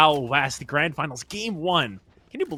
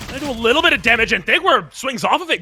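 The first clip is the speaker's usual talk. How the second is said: higher, louder, fast hype call; esports caster; 8 semitones higher, 9 dB louder than usual. Acted. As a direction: rattle it off as the play happens.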